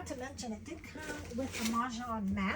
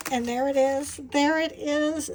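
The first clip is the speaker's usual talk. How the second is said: thrilled reveal; 3 semitones higher, 7 dB louder than usual. Real, not acted. Finding the flamingo tile, thrilled reveal. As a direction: announce the prize find.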